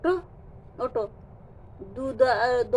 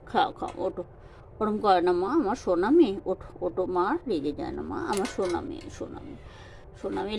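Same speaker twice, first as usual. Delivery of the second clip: softer, lower, gently pleading with a pet; soft coaxing; 6 semitones lower, 7 dB quieter than usual. Real, not acted. Coaxing a sulking pet to eat. (নরম গলায় আদর করে ভোলানো)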